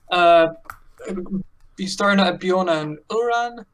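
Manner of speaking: ordinary talk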